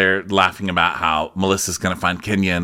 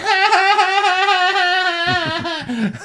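long, very high imitated laugh; mock cackle. Kenya laughing after her yacht jab, long mock cackle. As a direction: sustain a high-pitched fake laugh.

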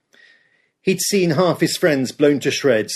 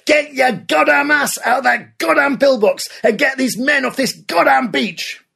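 furious screaming; 10 semitones higher than usual. Acted. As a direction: spit out every goddamn. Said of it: "Canham screamed back".

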